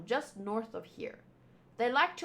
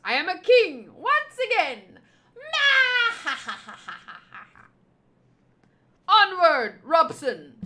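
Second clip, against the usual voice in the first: high, loud and pompous, a laugh breaking in; gloating villain king; 7 semitones higher, 6 dB louder than usual. Acted. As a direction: crow like a tyrant king reclaiming his castle. Reads villain king's lines.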